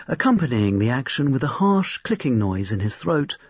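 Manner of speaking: plain reading voice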